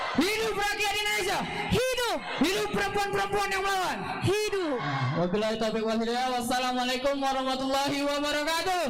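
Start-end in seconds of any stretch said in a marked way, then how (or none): 0.1-4.8 high shouted rally chant; fiery revolutionary speech. Acted. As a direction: rouse the crowd like a protest leader. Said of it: "itulah tadi orasi".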